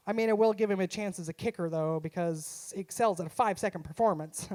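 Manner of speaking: ordinary talk, nothing marked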